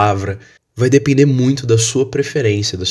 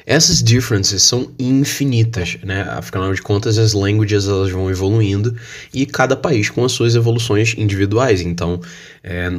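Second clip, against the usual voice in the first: ordinary delivery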